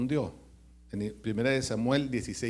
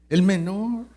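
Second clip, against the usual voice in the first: "el menor" shouted, loud and rising; emphatic shout; 5 semitones higher, 10 dB louder than usual. Real, not acted. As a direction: belt out "el menor". Emphatic call-out. ("el menor" gritado y fuerte)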